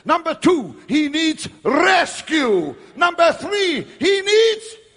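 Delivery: rising from loud preaching to high, slow shouts of rescue; building to shouts. Retold or imitated. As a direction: climb to a desperate cry for help.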